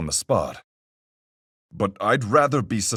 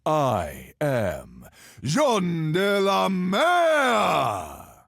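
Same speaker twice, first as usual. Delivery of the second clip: slow, high, long-drawn heroic cry; grand boastful proclamation; 9 semitones higher, much slower than usual. Acted. Sailor hailing himself king of the seas, grandiose proclamation. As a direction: stretch out the name like a sea king's fanfare.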